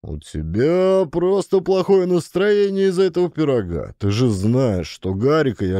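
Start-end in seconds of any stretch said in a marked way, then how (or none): 0.0-3.9 defensive protest. Acted. Husband defends himself to his wife.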